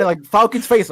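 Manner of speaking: ordinary delivery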